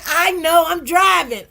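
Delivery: loud, high mock shout; shouted quote. Viewer typing while driving, loudly quoted. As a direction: yell it like a busy driver. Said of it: "She said".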